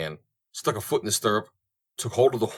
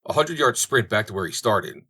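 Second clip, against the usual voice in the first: usual speaking voice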